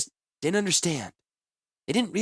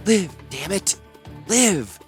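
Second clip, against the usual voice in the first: desperate command; 10 semitones higher, 3 dB louder, slower than usual. Acted. Healer doing CPR desperately.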